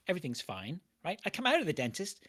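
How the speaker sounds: usual speaking voice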